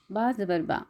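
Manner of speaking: ordinary talk, nothing marked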